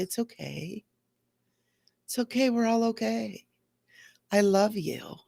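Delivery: put-on soft, tender reassurance to a child; soothing. Comforting her hurt feelings like a child, softly and tenderly. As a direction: rock and soothe it gently.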